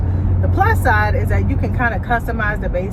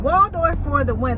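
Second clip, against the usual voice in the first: cheering; 8 semitones higher than usual. Real, not acted. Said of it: "let me just say".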